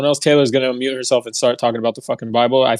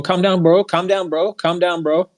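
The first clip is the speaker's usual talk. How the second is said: high, sing-song mock of a patronising woman; mock condescension; 6 semitones higher than usual. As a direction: ape her smug repeated brush-off, pitched high. Mocking her repeated put-down.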